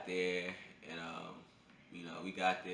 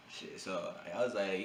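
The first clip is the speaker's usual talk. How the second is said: ordinary talk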